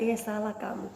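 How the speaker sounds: soft Holy Spirit warning; voice of conscience. Acted. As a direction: quietly warn like the Spirit within. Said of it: "Tapi Roh Kudus lagi katakan".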